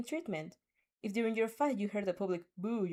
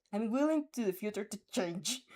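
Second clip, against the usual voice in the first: usual speaking voice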